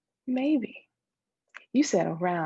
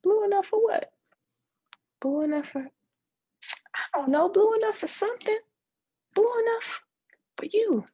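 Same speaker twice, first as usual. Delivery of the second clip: high strained voice; 12 semitones higher, 4 dB louder than usual. Acted. Girl pleading with her friend, high and strained.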